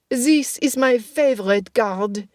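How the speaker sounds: lightly voiced Russian accent. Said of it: "Her Russian accent returned".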